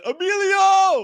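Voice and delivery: high, slow, drawn-out shout; shouting a celebrity's name in delight; calling out a name. Retold or imitated. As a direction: stretch the name out as a fan's yell.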